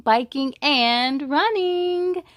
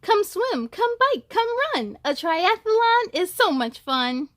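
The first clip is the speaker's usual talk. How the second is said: lively voiced note; 7 semitones higher, 3 dB louder than usual.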